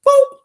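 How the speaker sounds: a very high, loud squeak of a boop; high squeaky boop. Pretend. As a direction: pop it out like a cartoon sound effect. Sound effect after a reveal.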